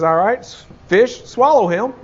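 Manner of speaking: hammed-up God ordering the fish; mock divine order. God commanding a fish to swallow the runaway Jonah.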